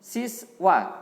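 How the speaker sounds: loud, high model word to repeat; sounding out words. Shown as a demonstration. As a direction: call the word out slowly to pupils. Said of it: "Sekali lagi". (mengeja kata dengan lantang)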